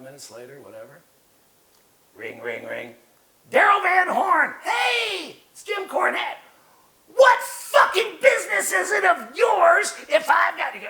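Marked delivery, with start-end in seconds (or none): 3.5-10.9 high, loud furious yelling impression; high-pitched shouting tirade, laughing through it; mock Cornette scream. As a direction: scream it down the phone like a furious boss.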